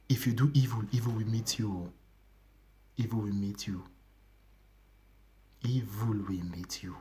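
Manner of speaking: low and soft, sinking to a whisper; solemn low warning fading into whisper; hushed warning. Retold or imitated. Quoting Jesus warning Peter, repeated warning.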